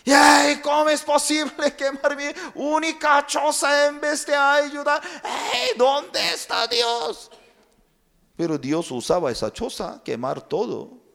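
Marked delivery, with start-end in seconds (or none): 0.1-7.3 high, loud desperate lament; anguished complaint to God. Acted. Man thinks aloud about burned hut, high loud lament. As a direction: pour out despair to God. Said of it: "Él pensaba".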